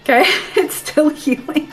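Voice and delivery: laughing through the words; giggling despite a sore rib; stifled giggles. Real, not acted. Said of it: "when I laugh".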